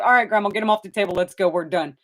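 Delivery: fast, eager wrap-up; hurried. Retold or imitated. Photographer hurrying the grandma out after the shot, fast and eager. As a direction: rush them out the door. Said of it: "And I'm like".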